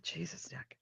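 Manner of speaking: whispered sleepy disbelief; barely voiced, under the breath; muttered exasperation. Reacting to late-night notification.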